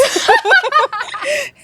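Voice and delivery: loud high burst of laughter; big laugh. Real, not acted. Son's boarding school remark.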